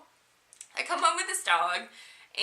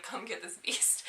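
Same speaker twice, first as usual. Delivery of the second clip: mock grumbling family; 9 dB quieter than usual. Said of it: "they're like".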